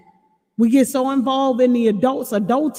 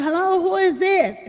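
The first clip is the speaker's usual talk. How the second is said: light, higher mock boy asking who's there; imitating little boy; 7 semitones higher than usual. Little boy answering a stranger online, mock child voice. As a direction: play the curious little boy on the headset. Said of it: "Two, two little boys".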